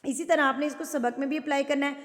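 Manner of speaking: usual speaking voice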